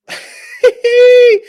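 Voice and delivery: high, loud burst of delighted laughter; a big, delighted cackle. Real, not acted. Fan reacting to the play.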